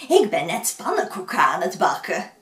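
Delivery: put-on sweet old woman tempting with pancakes; friendly old woman. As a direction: lure them with a treat. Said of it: "Gelukkig sprak ze vriendelijk".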